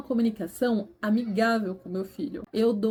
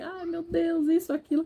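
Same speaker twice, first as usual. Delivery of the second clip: mock fretting; 6 semitones higher than usual. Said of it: "você fala assim".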